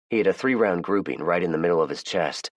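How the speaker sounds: usual speaking voice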